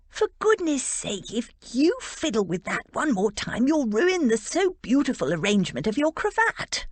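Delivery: a fussy friend fretting over a cravat; fussy best man. Acted. Best man chides groom.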